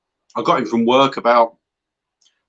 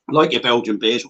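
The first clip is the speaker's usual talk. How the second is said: ordinary talk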